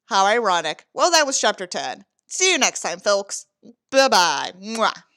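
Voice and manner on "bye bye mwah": ordinary delivery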